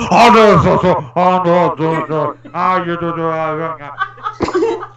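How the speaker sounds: low, stammering mock panic; imitating panicky Cody. Imitating Cody panicking on camera, low mock stammer. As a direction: stutter like a flustered friend.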